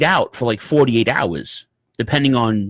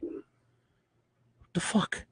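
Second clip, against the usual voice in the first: whispered disbelief; 6 semitones higher, 15 dB quieter, much slower than usual. Real, not acted. Whispered aside.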